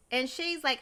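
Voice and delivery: normal talking voice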